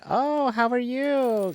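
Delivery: high, drawn-out imitation of a friendly greeting; sing-song retold hello; gushing greeting. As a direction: coo it high and slow, imitating an acquaintance. Retold greeting from clinic lady.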